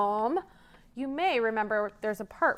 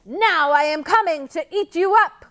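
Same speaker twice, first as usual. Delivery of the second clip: loud, higher troll cry; troll menacing the goat; troll threat; 7 semitones higher, 9 dB louder than usual. Acted. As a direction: threaten to gobble him. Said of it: "cried the troll".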